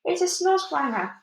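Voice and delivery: high, frightened exclamation; alarmed cry. A narrator's reading. Police chief cries out in fright. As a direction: cry out the monster's name. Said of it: "cried the chief of police".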